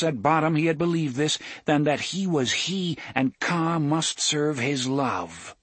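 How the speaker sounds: ordinary delivery, nothing marked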